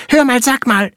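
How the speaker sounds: high, quick little flea voice; squeaky tiny creature. Acted. Flea character speaks.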